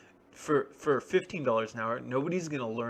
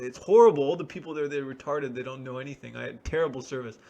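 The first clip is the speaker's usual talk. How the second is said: angry complaint; 2 semitones higher, 8 dB louder than usual. Retold or imitated. Customers venting about the store in angry reviews. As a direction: rant like an outraged customer. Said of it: "They say".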